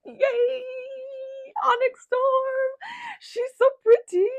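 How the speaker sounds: very high, loud, drawn-out squeals of delight; excited squeal. Real, not acted. Reader revealing her long-awaited book, squealing with excitement. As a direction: squeal with real glee.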